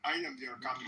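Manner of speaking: low, soft try at a Somali accent; half-hearted Somali accent, then gives up; attempted Somali accent. Shown as a demonstration. Streamer trying a Somali accent as the pirate captain. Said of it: "I can't do a Somali accent".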